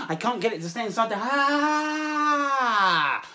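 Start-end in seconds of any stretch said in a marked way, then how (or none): 1.2-3.2 loud, high, drawn-out "aah"; long frustrated cry. Acted. Wand will not stay in cover.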